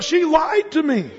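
betrayed passenger. Acted. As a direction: cry out that she lied. Duped passenger.

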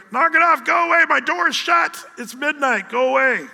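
loud, high, fed-up yelling; annoyed shout. Retold or imitated. Woken sleeper yells through the door at the neighbor, high exasperated shout. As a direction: yell at the neighbor to leave you alone. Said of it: "You're like".

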